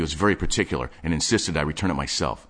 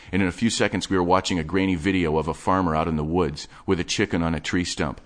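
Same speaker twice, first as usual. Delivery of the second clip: ordinary delivery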